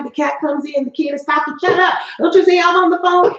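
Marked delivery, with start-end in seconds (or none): 1.6-3.3 put-on snapping at the kid, much higher and louder; frazzled yell. Shown as a demonstration. Parent on a collection call yelling at the kid, much higher and louder. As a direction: lose your temper mid-call and bark at the kid. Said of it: "if you start up here with them".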